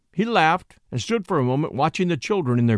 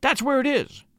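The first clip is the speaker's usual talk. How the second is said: high, restrained panicky cry; excited high exclamation without full volume; acted scream; 9 semitones higher than usual. A narrator's reading. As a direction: insist frantically, voice pitched way up. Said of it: "she screamed".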